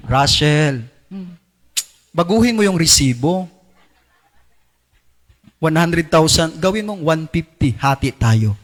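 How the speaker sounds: tempting voice. Acted. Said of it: "bumubulong din. Nangangalabit din yan".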